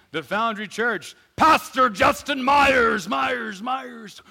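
booming hype-man introduction, echoing the name; arena announcer roaring out the pastor's name; mock announcer shout. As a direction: announce him like a rock-star preacher.

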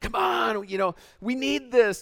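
sideline urging. Retold or imitated. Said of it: "on the sideline".

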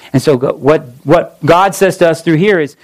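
ordinary delivery

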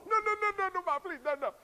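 put-on high, pleading kid voice; child pleading with mom not to tell dad; mock begging child. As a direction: beg mom in panic.

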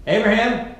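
loud, high summoning call of a name; parent calling out. Acted. God summoning Abraham like a parent, loud call. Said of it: "Parents, you know the voice I'm talking about?".

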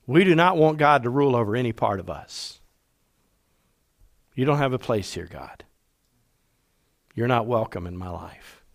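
low, hushed rejection of God; quiet defiance. Acted. People telling God he has no place, quiet defiance. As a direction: softly shut him out, low and measured.

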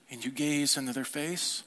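ordinary talk, nothing marked

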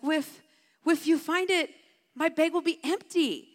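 put-on high little-girl voice, f for th; high-pitched, cutely mispronouncing child; imitating concerned child. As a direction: act out a small kid's worried explanation. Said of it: "she was so concerned".

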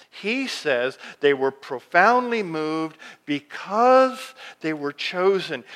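ordinary talk, nothing marked

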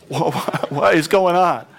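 a dazed man on the floor, baffled; bewildered. Retold or imitated. Saul on the ground, startled and bewildered. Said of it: "he's down there on the floor going".

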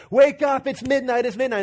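high, loud imitation of a kid shouting; excited child's mock yell; urgent wake-up call. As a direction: rouse a sleeper, starting loud and easing off. Shaking the sleeping twin awake.

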